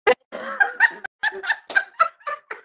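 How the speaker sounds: a burst of high mocking laughter. Real, not acted. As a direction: laugh it off, squealing. Mocking a rival on live.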